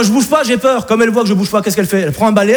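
ordinary talk, nothing marked

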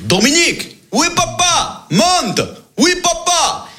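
high shouted calls between floors; calling out. Acted. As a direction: yell up and down the stairs. Father and son calling between floors. (appels criés d'un étage à l'autre)

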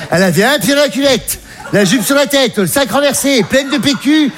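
high-pitched comic outrage; agitated retelling. Acted. Describing the shocking spectacle he found. (récit affolé, voix haut perchée)

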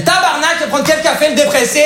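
high, sing-song Québécois waitress; a cheeky Québécois waitress teasing in her accent. Retold or imitated. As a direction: throw it out in a broad Québécois accent. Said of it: "j'ai pris l'accent québécois".

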